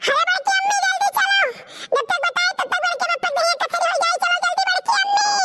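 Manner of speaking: high hurried plea; urgent panicked shout. Acted. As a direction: hurry her along in alarm. Child urging aunt hurry. (घबराहट में जल्दी बुलाना)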